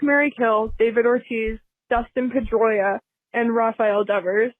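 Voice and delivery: ordinary delivery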